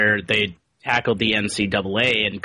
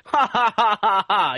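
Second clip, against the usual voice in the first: mocking cartoon laugh; 9 semitones higher than usual. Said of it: "And he's like".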